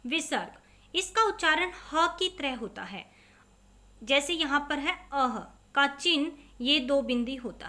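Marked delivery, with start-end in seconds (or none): none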